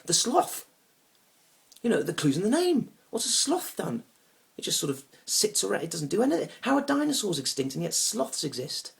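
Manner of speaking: loud, high-pitched comic outrage; indignant rant. Acted. Man disputing evolution with his date, indignant rant. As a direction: argue it with exasperated disbelief.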